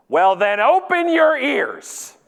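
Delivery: high, loud, drawn-out mock scolding; snapped rebuke. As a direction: hammer it out slowly, indignant. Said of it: "looks me right in the eyes and says".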